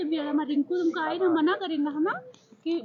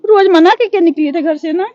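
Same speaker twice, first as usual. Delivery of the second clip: louder, hammered scolding; shouted warning; 15 dB louder than usual. Real, not acted. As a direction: yell the warning right at her. Mother repeats warning, now shouting.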